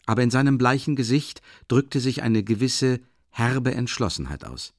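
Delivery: normal talking voice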